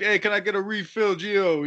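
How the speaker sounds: high-pitched pretend Italian New Yorker; hammed-up Italian-American regular; mock Italian accent. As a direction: call to the owner in a put-on Italian accent. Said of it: "acting like I was Italian".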